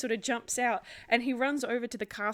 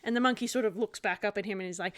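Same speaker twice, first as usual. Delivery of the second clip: normal talking voice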